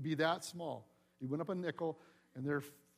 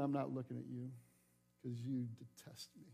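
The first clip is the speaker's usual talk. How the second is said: snubbing; 5 semitones lower, 5 dB quieter than usual. Retold or imitated. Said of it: "doing the snub".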